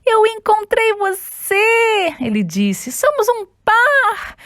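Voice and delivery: high, slow delighted cry; overjoyed. Acted. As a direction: cry out with joy. Simone finds her pair.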